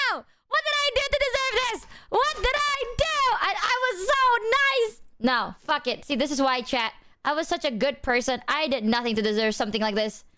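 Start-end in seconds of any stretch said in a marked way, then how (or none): 0.1-5.0 high, wailing mock despair. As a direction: whine it like a wronged child. Fake outrage at the redeem.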